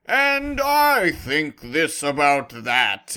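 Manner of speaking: loud, slow put-on male voice; bad male voice imitation. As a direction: ham up a narrator faking a man. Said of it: "her male voices were terrible".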